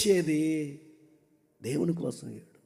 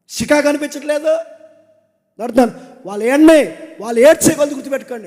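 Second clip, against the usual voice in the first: loud pulpit exhortation with punched commands; full-force preaching; 7 semitones higher, 11 dB louder than usual. Acted. Preacher urging the men of the church, loud and fervent.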